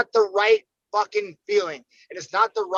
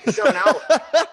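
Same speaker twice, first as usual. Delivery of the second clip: laughing repeat; 6 semitones higher, 5 dB louder than usual. Retold or imitated.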